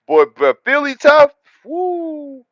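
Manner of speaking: loud, high-pitched disbelief, capped with a woo; amazed exclamation. Real, not acted. Streamer reacting to Fancy being Philly tough, amazed. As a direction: blurt it out loud and high, astonished.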